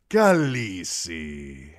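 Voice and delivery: drawn-out, loud and slow Khaleesi, KAL-ee-see; Daario's cry. A narrator's reading. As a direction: savour the word like a flatterer calling her. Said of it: "he cried".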